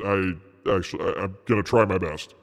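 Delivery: deep announcer recap voice. Acted. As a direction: narrate like a TV recap. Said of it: "I will use the recap voice".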